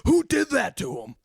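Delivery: high, loud outraged demand; mock-furious shouted question; imitated angry yell. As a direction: yell it like an irate friend on the phone. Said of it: "Fry's yelling at me on the phone".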